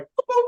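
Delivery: very high, squeaky "boop, boop"; tiny high-pitched notification blips; imitated text pop-up. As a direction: pip like two message alerts. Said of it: "you and Sabrina were like".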